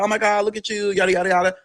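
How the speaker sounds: high-pitched mock gushing; relatives' fond fuss, lightly acted. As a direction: imitate excited cousins cooing. Cousins gushing over the teller.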